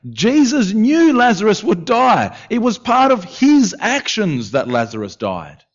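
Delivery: high-pitched, incredulous rhetorical questions; impassioned preaching. Acted. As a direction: plead with the congregation for an answer. Preaching at full force.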